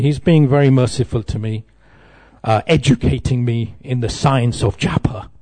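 ordinary talk

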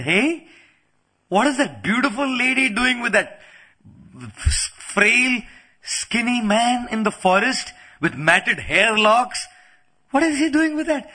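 high-pitched, acted incredulous questioning; Shurpanakha. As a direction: play a provoking tale-bearer, voice lifted. Acting Shurpanakha provoking Ravana.

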